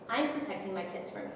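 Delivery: low-key, softened retort; soft, controlled defiance; quiet firm answer. Retold or imitated. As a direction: stand your ground quietly, retelling it. Her firm answer to his shouting.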